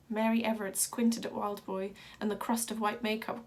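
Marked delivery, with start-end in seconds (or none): none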